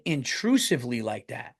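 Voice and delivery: ordinary talk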